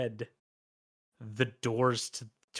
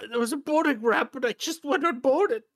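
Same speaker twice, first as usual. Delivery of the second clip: high, hurried explanation; pitiful man gabbling his excuse; flustered crawling man; 14 semitones higher, 4 dB louder, faster than usual. Acted. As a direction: explain quickly in a high, strained voice. Acted beggar explaining himself.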